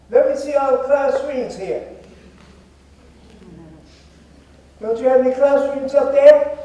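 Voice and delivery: high, loud call across the hall; calling out to audience. Real, not acted. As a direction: call out to the crowd, demanding an answer. Calling out to the cadets.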